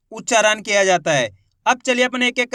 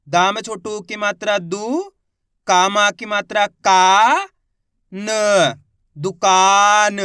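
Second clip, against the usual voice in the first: stretched syllables, then the word louder; slow sounding out; 4 dB louder, much slower than usual. Shown as a demonstration. Teacher sounding out a word for beginner readers, slowly. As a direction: drag each syllable, then land the word. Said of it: "teacher sounds out word".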